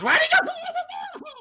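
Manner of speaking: drawn-out, very high shriek; piercing, slow creature wail; goblin scream. Acted. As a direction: start screaming and stretch the words out. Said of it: "he begins to start screaming this word".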